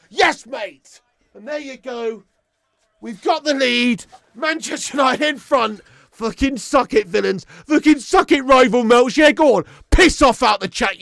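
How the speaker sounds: loud, high jeering at rivals; gloating taunt. Real, not acted. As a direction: rub it in the rivals' faces. Taunting rival fans in chat.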